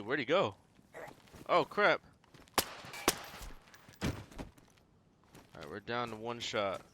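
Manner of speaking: ordinary delivery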